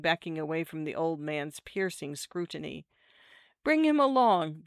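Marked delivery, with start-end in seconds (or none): 3.6-4.6 high, louder curt order; curt lady's command. A narrator's reading. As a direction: order it curtly, pitched well up. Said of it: "the married lady said curtly".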